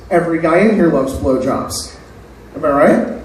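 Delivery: usual speaking voice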